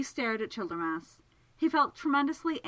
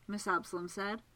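ordinary delivery

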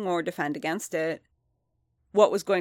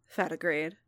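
ordinary delivery, nothing marked

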